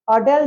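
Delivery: अटेल for hotel, no H; old silent-H pronunciation. Shown as a demonstration. Teacher recalling old usage for students: अटेल without H. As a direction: drop the H, starting अटेल on a vowel. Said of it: "जब हम pronounce करते थे".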